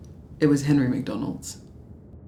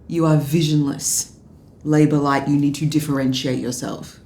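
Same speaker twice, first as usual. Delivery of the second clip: retold harangue; 8 dB louder than usual. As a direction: bark it like a boss dressing him down. Retold director barging in.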